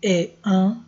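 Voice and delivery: ordinary talk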